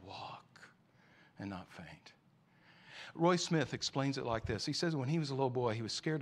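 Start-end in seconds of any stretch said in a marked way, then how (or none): 0.1-2.1 unvoiced hush on walk, soft and low on and not faint; whispered. Shown as a demonstration. Preacher hushing the congregation on the promise, whispering. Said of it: "he will give you the strength to".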